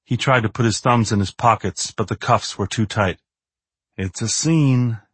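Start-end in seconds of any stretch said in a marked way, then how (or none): 4.0-5.0 out of the side of the mouth. Acted. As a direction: mutter it sideways, slowly. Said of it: "he said out of the side of his mouth".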